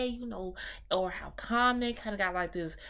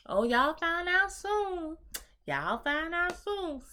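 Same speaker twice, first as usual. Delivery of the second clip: slow, higher teasing taunt; teasing sing-song; 9 semitones higher, slower than usual. Retold or imitated. Sister teases the family with a slow, higher singsong. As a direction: dangle the secret, drawn out and singsong.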